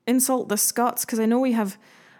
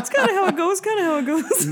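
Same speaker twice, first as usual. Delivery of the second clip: laughing; 9 semitones higher than usual. Real, not acted. Mock old-timey reply.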